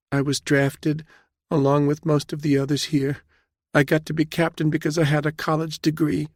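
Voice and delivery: put-on high-pitched, meek young woman; nervous junior officer, voice held high; frightened young captain. A narrator's reading. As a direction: explain timidly to a superior.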